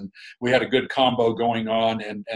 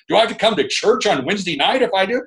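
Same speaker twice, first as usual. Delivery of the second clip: higher, quick hammed-up husband's question; mock husband; 8 semitones higher, 4 dB louder, faster than usual. Husband asking the preacher about church on Wednesdays, quick and higher. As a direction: rattle off the reluctant husband's objection, pitched up. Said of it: "every week ... that he would say".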